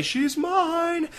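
high whiny mine; the wrong delivery; whiny take. Shown as a demonstration. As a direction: whine mine like a sulk. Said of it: "you don't say".

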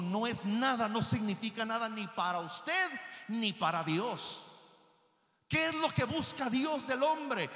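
loud, high preaching at full force; rousing pulpit shout; fiery preaching. Acted. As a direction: preach it hard to the congregation.

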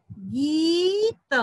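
गीत with a rising, stretched ee, then त; long vowel. Shown as a demonstration. Teacher building the word for children, drawn-out गीत. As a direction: draw the ee upward in गीत. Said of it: "तो शब्द बना".

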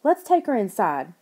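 usual speaking voice

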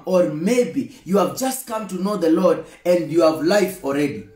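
normal talking voice

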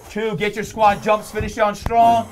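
rising to a loud push on the last rep; coaching shout. Real, not acted. Trainer shouting the host through his last squat jumps, loud. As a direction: drive him through the finish. Trainer calls out final reps.

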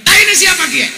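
loud surprised Ngapak yelp; shouted question. Retold or imitated. Cilacap man yelling at the Sundanese villager. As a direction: cry out in disgust after stepping in it. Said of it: "Orang Cilacap teriak".

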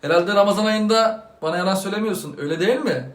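ordinary delivery

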